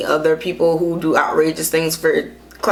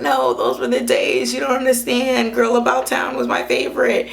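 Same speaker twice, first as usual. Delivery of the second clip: nostalgic fan; 7 semitones higher than usual. Retold or imitated. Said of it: "it's like".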